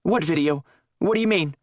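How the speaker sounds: high, alarmed questions; panicked. A narrator's reading. Woman confronted about the tape, alarmed questioning. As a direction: scramble to deflect.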